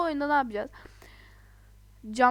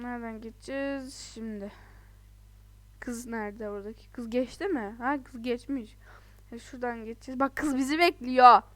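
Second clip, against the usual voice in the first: usual speaking voice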